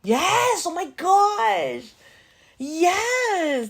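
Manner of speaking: high, loud drawn-out squeal; excited shriek of recognition; thrilled. Real, not acted. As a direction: gush with real delight. Recognises a loved film.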